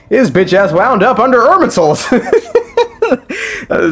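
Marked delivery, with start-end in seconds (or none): 2.2-3.6 high burst of laughter; real laugh. Real, not acted. Laughing at his joke about Remus ending up under Irminsul, high laugh. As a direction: break into laughter at your own line.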